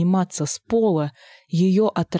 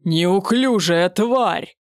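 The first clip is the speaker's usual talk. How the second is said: slow, louder monstrous roar; deep distorted monster roar; 4 dB louder, much slower than usual. A narrator's reading. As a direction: roar it out with contempt. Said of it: "взревел псевдо-Костя".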